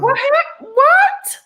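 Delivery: very high, loud squeal of disbelief; shocked disbelief. Retold or imitated. Patient told no to both eyes, shrieking in shock. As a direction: squeal it out, mock-aghast.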